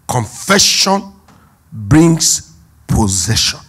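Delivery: each word drawn out very slowly; "confession" and "brings possession" stretched very slowly and loud; mock slogan. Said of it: "you'll be told".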